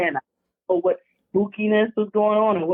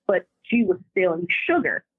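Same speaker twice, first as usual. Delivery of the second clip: ordinary talk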